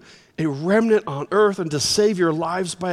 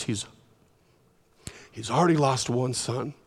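pleading; 7 semitones lower, 5 dB quieter than usual. Retold or imitated. Judah begging at Joseph's feet.

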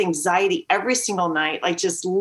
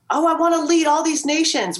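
mock grandiose; 7 semitones higher than usual.

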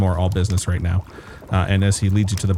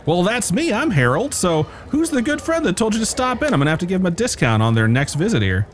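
higher, eager innkeeper voice; cheery innkeeper; 10 semitones higher than usual. Acted. Innkeeper Harold greeting new arrivals, high and eager. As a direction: play the jolly barkeep delighted to see guests.

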